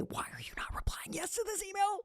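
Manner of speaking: high, strained whisper of exasperation; suppressed anger. Acted. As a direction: hold the anger in, squeezed thin and very soft. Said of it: "judgment and anger rising up in me".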